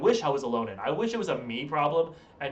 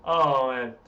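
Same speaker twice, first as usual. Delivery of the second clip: ordinary talk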